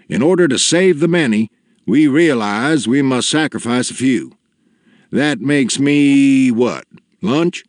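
father offering himself. A narrator's reading. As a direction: submit grandly to the giant.

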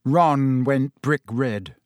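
plain reading voice

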